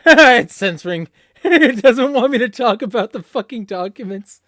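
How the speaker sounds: laughing in disbelief while talking; cracking up at the censor. Real, not acted. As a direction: laugh through the words, loud and high.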